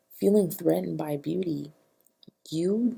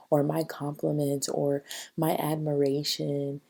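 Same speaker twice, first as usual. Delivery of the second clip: usual speaking voice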